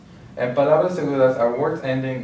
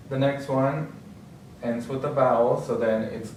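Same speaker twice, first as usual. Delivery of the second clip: normal talking voice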